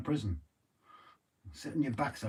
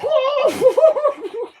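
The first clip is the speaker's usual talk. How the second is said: loud squealing laugh; high shrieking laugh; 14 dB louder than usual. Real, not acted. Laughing after being licked.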